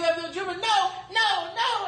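rising, insistent retold refusals; building refusals. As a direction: build the rejection to a climax. Story builds to desperate climax.

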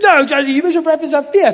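high, loud mock outburst; cross child. Son losing it at his sister over her prayer, cross. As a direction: imitate an exasperated boy scolding his sister. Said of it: "So he's cross".

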